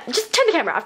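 usual speaking voice

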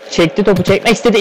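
normal talking voice

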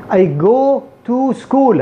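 "go" and "to" with long rounded vowels, slow. Shown as a demonstration. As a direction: drag out full "goo" and "too". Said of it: "هذا اللغة العربية أو العرب".

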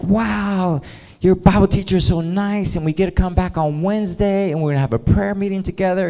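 high, gushing excitement, imitated; mock-excited gushing, speeding up at the end; all excited. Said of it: "like, all excited".

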